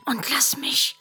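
quick, whispered hush; urgent whisper. Real, not acted. Mother whispers urgently so Elli won't reveal the ending. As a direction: shush her fast before she spoils it.

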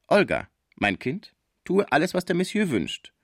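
mildly stern, rising high after the name; stern mother. A narrator's reading. Mother orders her child to obey the guest, mildly stern. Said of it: "sagte die Schließerin mit strenger Miene".

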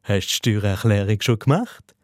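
low, falsely innocent question; lightly hypocritical casual asking; mock sanctimonious. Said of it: "so ganz scheinheilig".

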